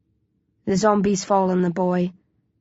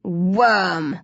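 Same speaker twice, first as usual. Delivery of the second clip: leering. A narrator's reading. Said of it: "Kathy leers again".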